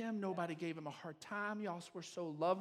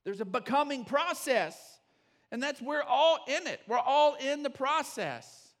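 loud, high preaching; full-force preaching; 7 semitones higher, 10 dB louder than usual. Acted. Pastor rallying the church about growth, preaching at full force. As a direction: drive it home to the congregation.

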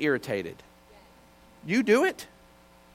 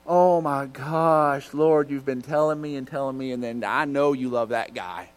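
mortified dismay; 5 semitones lower than usual. Retold or imitated. Driver to the Lord after insulting assistant pastor, mortified. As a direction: drag it out in comic dismay.

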